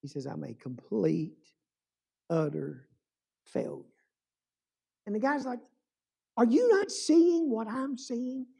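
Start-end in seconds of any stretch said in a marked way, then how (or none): 0.3-3.8 retold despair. Crying violinist condemning himself to his friend, low and despairing. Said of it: "And he's crying". 6.4-8.5 high, drawn-out disbelief; incredulous friend. Retold or imitated. Friend urging the violinist to see the crowd, high and incredulous.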